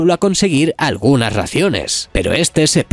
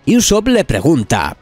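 ordinary delivery, nothing marked

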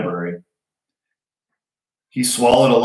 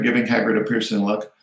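ordinary delivery

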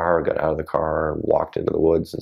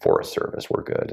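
mock official reassurance; 12 semitones lower, 5 dB quieter than usual. Quoting dismissive Forest Service officials.

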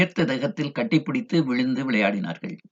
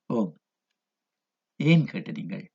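soft, low and tender; gentle voice; 4 semitones lower, 5 dB quieter than usual. A narrator's reading. Mother answers her watchful daughter, gently and quietly. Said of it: "மென்மையான குரலில் சொன்னாள்".

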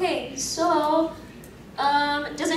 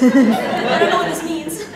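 laughing through the words; 4 semitones higher, 9 dB louder than usual. Real, not acted. Cosplayer laughing at the crowd of lookalikes. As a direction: giggle it out, baffled.

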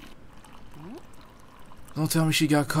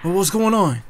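usual speaking voice

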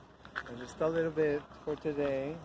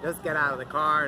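louder barked command; mock police order; 4 semitones higher, 8 dB louder than usual. Cop ordering the driver out, louder. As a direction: bark it like a traffic cop. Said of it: "And then it's like".

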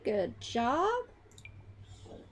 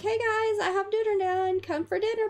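high, louder calling-out mom; 7 semitones higher, 4 dB louder than usual. Pretend. Mom calling everyone to dinner, high and loud. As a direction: call the family like a mom. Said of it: "Be like".

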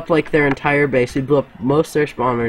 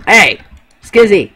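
calling out; 6 semitones higher, 8 dB louder than usual. Real, not acted. Calling out to teammate.

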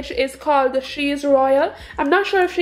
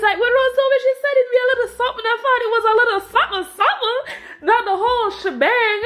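excited rush; 8 semitones higher, much faster than usual. Real, not acted. Gushing over friend's surprise gift.

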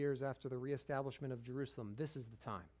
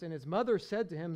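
normal talking voice

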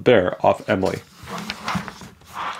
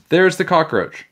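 high-pitched alarmed call; shout; 9 semitones higher, 3 dB louder than usual. A narrator's reading. Michael alerting everyone to the cockroach, shout. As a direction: point it out urgently. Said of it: "Michael suddenly shouted".

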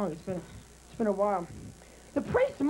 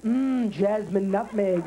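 put-on dead kid sniffing; 9 semitones lower, 3 dB louder, slower than usual. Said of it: "the kid's gonna jump up and go".

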